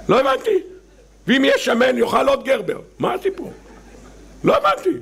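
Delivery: very high bewildered questions; incredulous. Acted. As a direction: sound utterly baffled, squeaking. Incredulous comic reaction. (תמיהה בקול גבוה מאוד)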